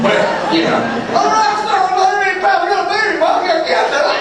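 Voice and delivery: high-pitched panicked rambling, hammed up; blustering fool. Caller unloading on the hotline worker, blustering. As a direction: babble on in a high mock bluster. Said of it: "listening to this blustering fool".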